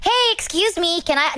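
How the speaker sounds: high, bright streamer voice; cheery stream voice. Shown as a demonstration. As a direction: sound upbeat and perky. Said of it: "I wasn't talking like this".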